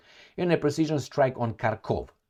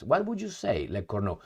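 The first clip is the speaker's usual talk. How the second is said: ordinary delivery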